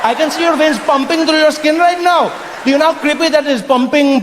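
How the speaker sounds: high, insistent Indian man; appalled Indian local. Acted. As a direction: gawp aloud at a very strange stranger. Indian local diagnoses pale coloniser.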